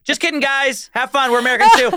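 high, laughing, playful reveal; spring the joke on them as you leave; teasing high-pitched goodbye to fooled students; gleeful reveal. Retold or imitated. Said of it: "we were like".

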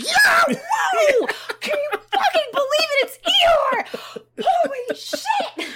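high, loud crowd cheering; screaming crowd. Retold or imitated. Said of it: "everyone's like".

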